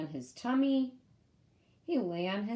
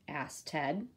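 usual speaking voice

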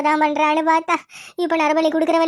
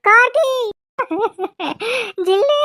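calling out; 5 semitones higher than usual. Acted. Mother calls out for Karthi. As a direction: shout the names into the empty house.

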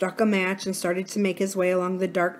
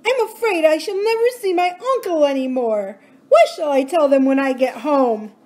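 put-on high, crying child's voice; boy who lost his uncle, in tears; tearful boy; 8 semitones higher, 6 dB louder than usual. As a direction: sob out the fear for his uncle. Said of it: "burst into tears".

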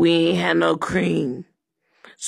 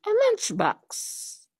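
high squeak; 13 semitones higher, 7 dB quieter, slower than usual. Acted. Mock answer punchline.

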